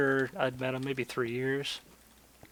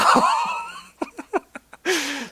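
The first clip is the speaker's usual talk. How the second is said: long real laugh. Real, not acted. Laughing at his own story.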